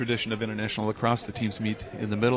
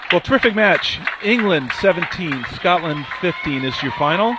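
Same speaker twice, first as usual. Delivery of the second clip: play-by-play; 4 semitones higher, 7 dB louder than usual. Real, not acted. Calls the final horn.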